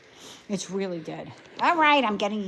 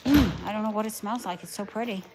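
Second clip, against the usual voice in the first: ordinary talk, nothing marked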